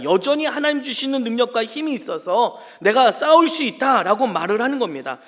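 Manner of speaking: ordinary talk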